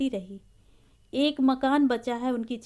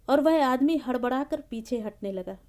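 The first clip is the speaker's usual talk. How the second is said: usual speaking voice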